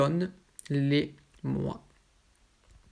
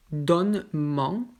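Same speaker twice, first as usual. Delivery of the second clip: donne-m'en spelled out slowly, each sound clear; slow careful model; 3 dB louder, much slower than usual. Shown as a demonstration. French teacher models the correct form slowly. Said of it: "c'est la forme parlée, comme ici".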